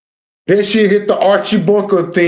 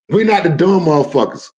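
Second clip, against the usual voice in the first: ordinary talk, nothing marked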